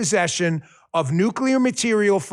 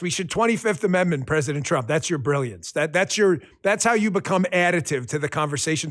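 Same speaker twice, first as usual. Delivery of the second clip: ordinary talk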